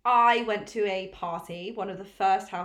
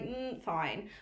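unimpressed; 8 dB quieter than usual. Retold or imitated. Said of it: "my body before lockdown was like".

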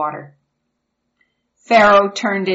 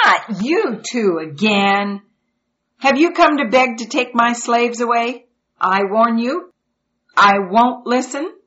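annoyed king; 5 semitones higher, 5 dB louder than usual. Acted. Pharaoh confronts Moses and Aaron.

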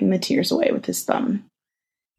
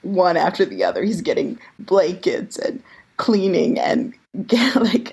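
laughing. Real, not acted. Mocking unrealistic fanfic boyfriend.

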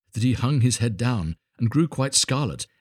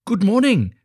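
high, loud, eager greeting; delighted little Hans; 12 semitones higher, 8 dB louder than usual. Acted. Hans answering the miller from his garden, delighted high greeting. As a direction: pipe up brightly as an overjoyed simple friend.

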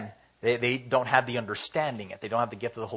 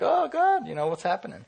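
high panicked yelp; a hammed-up, fleeting panic; mock panic; 8 semitones higher than usual. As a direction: play a person freaking out, pitched way up. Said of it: "People freak out, like".

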